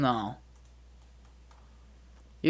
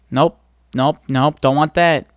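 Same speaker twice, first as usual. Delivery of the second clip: loud rejecting nopes; 10 dB louder than usual. Real, not acted. Rejecting search results.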